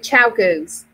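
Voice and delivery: slow "Chow Goos", first part CHOW as in ciao; confirmed pronunciation. Shown as a demonstration. Host recognising the brand name, confirmed pronunciation. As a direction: repeat "Chow Goos" as two words, CHOW GOOS. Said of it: "a, a pronunciation".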